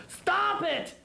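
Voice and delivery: high shouted "stop it"; screaming. A narrator's reading. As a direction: yell at them to stop. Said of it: "a screaming".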